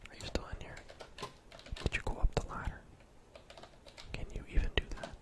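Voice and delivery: ordinary talk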